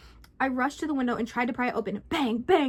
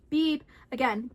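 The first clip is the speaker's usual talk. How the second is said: ordinary delivery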